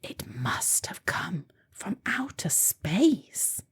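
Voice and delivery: hushed, voiceless whisper of wonder; a secret whisper to himself. A narrator's reading. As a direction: breathe it out in awe, barely audible. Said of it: "he whispered to himself".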